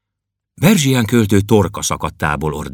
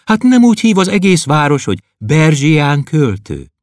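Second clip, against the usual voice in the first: high, louder encouraging voice; encouraging girl; 8 semitones higher, 4 dB louder than usual. Acted. Klopédia encouraging the sad poet, high girlish voice. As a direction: cheer him up brightly. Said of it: "mondta neki bátorítón".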